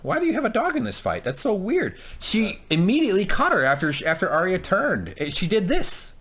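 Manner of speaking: high, insistent protest; exasperated arguing. Real, not acted. Co-host argues over Arya's wound, exasperated high insistence. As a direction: argue it indignantly, pitch climbing. Heated argument over whether Arya was cut.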